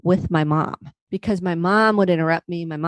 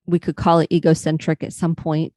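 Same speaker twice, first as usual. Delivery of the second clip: ordinary talk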